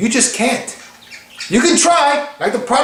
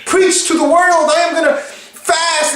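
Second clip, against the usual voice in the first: high, pleading overpromises to God; mock begging; 6 semitones higher than usual. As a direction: beg in an exaggerated, high-pitched plea. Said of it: "you don't have to come begging".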